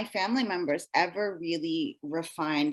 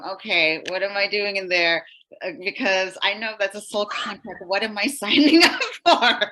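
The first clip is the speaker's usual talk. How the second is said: louder, rising into a laughing panic; mock freak-out; 2 semitones higher, 8 dB louder than usual. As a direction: play up your own bewildered freak-out, amused. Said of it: "kind of freaking out, going".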